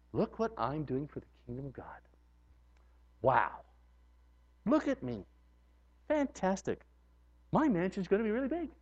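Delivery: hushed vain bragging; smug self-praise. Pretend. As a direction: admire yourself quietly.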